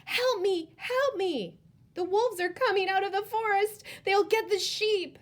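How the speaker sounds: high, loud desperate yell; boy shouting down to the village, panicked. Acted. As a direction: scream for help for real. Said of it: "He ran down the hillside shouting".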